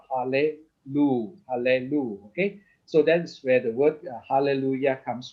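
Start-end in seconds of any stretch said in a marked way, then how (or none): 0.1-2.3 "hallelu" slowly, the schwa voiced as a short e; hallelu with vocal schwa. Shown as a demonstration. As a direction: spell out "hallelu" syllable by syllable, slow. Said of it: "it becomes a vocal schwa".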